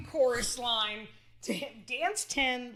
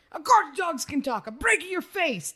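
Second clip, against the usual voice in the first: higher, hammed-up Italian accent; silly overplayed Italian accent; 4 semitones higher, 4 dB louder than usual. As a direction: play a cartoon Italian diner owner. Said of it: "really silly, overplayed ethnicities".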